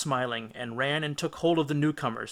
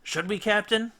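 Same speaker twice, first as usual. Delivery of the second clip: anxious; 7 semitones higher than usual. A narrator's reading. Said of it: "asked Polites anxiously".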